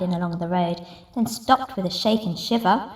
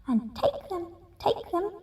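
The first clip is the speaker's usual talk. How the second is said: much softer fading echo; echo from cave; 8 semitones higher, 8 dB quieter than usual. Acted. Cave echoing the rat's shout. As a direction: echo it back softer each time. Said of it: "came back the welcome sound".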